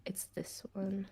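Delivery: whispered to herself. Real, not acted. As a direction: breathe it, barely voiced. Picking a video, off to herself.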